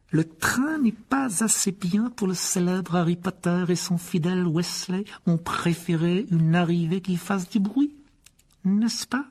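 aigu, lent, sarcasme venimeux; étirer les mots avec mépris; Rogue sarcastique. A narrator's reading. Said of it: "dit-il sans élever la voix". (professeur sarcastique, voix doucereuse)

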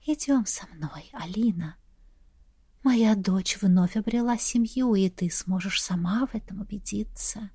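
breathy whisper like rustling wind; gusting windy whisper. A narrator's reading. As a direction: whisper it like a breeze in the ear. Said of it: "будто ветерок шелестел в ушах". (шёпот, как шелест ветра)

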